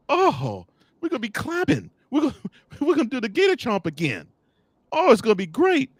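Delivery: high, thrilled fan chatter; mock giddy Gator fan. Host mocking Playmaker dreaming of Gator wins, high and giddy. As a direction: hype it up like a starry-eyed fan. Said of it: "We're gonna be going".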